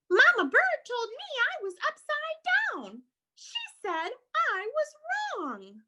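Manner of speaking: high, plaintive little bat voice; confused young bat. Acted. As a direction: protest in a high, childlike bat voice.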